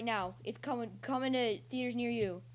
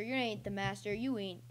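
ordinary talk, nothing marked

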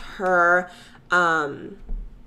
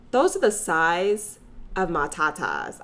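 usual speaking voice

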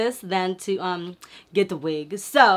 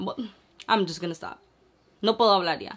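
ordinary talk, nothing marked

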